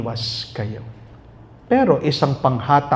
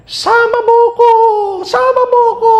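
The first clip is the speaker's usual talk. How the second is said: high, loud wailing cry at the grave; mourner's wail; 8 dB louder than usual. Retold or imitated. Priest imitating a grieving mourner at a burial, high wailing shout. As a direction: wail it to the coffin. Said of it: "may nakikita pa ako na sumisigaw".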